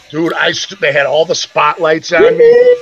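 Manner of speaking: ordinary talk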